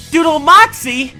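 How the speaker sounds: loud, high playful announcement; hyped shout. Pretend. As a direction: belt it out like a big reveal.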